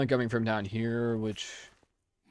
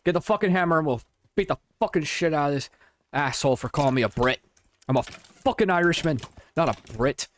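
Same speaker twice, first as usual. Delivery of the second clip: louder put-on Irish brogue, ranting; angry Irish accent; 4 semitones higher, 4 dB louder than usual. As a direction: rant like a proud Irishman insulted as a Brit. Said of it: "He's trying to sound Irish".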